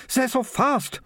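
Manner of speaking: high-pitched, lightly acted breathless alarm; acted out-of-breath girl; panting. A narrator's reading. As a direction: gasp it out as a frightened girl. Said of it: "Militia panted".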